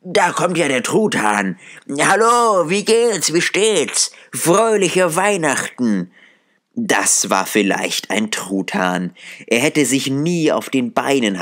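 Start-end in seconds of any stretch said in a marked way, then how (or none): 0.0-6.1 put-on high, giddy old man brimming with joy; a miser reborn, bursting with delight; overjoyed Scrooge.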